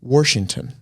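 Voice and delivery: Washington with an added r, "Worshington"; dad's Washington. Retold or imitated. Daughter copies dad's Washington with an r. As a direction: slip an r into Washington. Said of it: "he also says".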